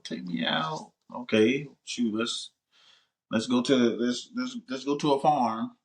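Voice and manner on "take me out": a hint of a higher, softer plea; lightly voiced date asking; acted date request. A date repeats the wish to go out, soft mock pleading.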